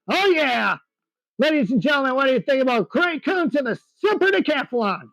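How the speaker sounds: lively live play-by-play for the crowd; air show announcer. Acted.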